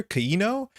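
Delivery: Kiino high, as kuh-ee-no; split vowels. Shown as a demonstration. Streamer repeating a name for viewers, syllable-split Kiino. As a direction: say Kiino with the double vowel parted. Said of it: "There's, like, two syllables here".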